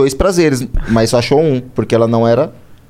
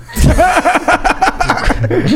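long high-pitched laugh; laughter; 12 semitones higher than usual. Real, not acted. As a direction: burst into high, drawn-out laughter. Reage à piada do amigo. (gargalhada aguda e longa)

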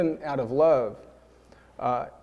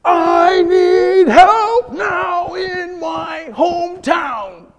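huge, high, very slow yell; furious shout; 12 dB louder, much slower than usual. Retold or imitated. Frustrated shopper yelling at an unhelpful clerk, furious. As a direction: blast every word out in outrage. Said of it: "that might be what's rising up within you".

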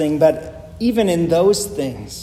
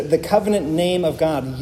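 ordinary delivery

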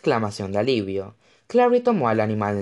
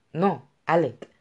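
a touch of annoyed impatience; irritated; 6 semitones higher, slower than usual. A narrator's reading. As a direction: brush him off, mildly irritated. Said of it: "respondió ella con irritación". (con leve irritación)

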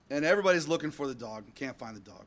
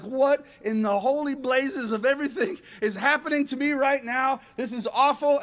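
high, loud mock panic; acted-out bewildered alarm; freaking out; 7 semitones higher, 4 dB louder than usual. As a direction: voice a panicking dog. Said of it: "he starts freaking out".

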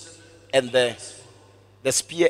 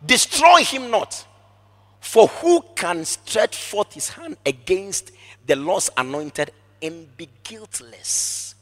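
slow command; 2 semitones higher, slower than usual. Acted. David commanding Abishai.

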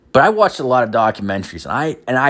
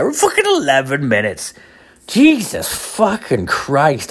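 high-pitched exasperation, stretching the curse; real dismayed disbelief; 5 semitones higher, slower than usual. Real, not acted. Podcaster finding only eleven minutes recorded, dismayed disbelief. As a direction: pitch jumps up, then drag out the swearing. Shocked at how short recording is.

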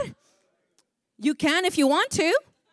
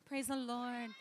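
tiny, very soft mock praise; trying to be cute; 3 semitones lower, 19 dB quieter, slower than usual. Preacher mocks timid worshippers who want miracles, trying to be cute. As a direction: barely voice it, low and dainty. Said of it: "but we trying to be cute".

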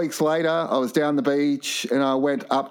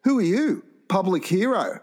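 higher-pitched mocking kid; cheeky kid; 6 semitones higher than usual. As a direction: challenge him like a lippy kid. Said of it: "one of the kids said to me".

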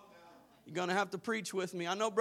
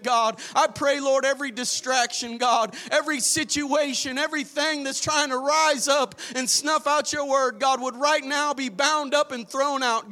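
praying aloud, growing louder; fervent prayer; 4 semitones higher, 6 dB louder than usual. Acted. Pastor leading the congregation in prayer, fervent and rising. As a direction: lead the church in prayer, rising.

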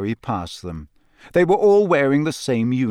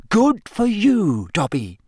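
put-on high, slow, approving girl's voice; Hermione, encouraging; 9 semitones higher, slower than usual. A narrator's reading. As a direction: praise with slow, raised emphasis.

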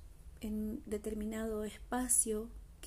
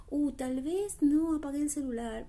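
mock "uh-oh" realization, higher and louder; sudden worried thought; 4 semitones higher, 5 dB louder than usual. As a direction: voice a nagging thought popping up, lightly alarmed. Acting out intrusive mental-noise thoughts. (preocupación repentina fingida)

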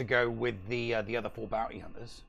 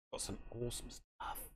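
breathy hushed whisper, much softer; 14 dB quieter than usual. Acted. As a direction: whisper it like a secret treasure. Gloating over thrift finds.

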